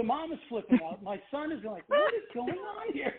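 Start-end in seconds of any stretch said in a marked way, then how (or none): 1.9-3.1 much higher, slow and baffled; bewildered kid voice. Retold or imitated. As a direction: play the puzzled son caught off guard. Son confused at flipping mom.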